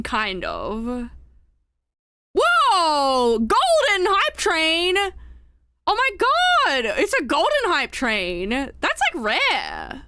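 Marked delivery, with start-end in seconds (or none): 2.3-8.5 shocked delight, shouting out; excited. Real, not acted. Golden hype train starts.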